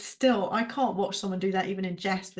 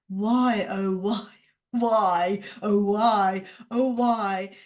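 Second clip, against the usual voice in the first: mock lament; 5 dB louder, much slower than usual. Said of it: "tired little child inside me".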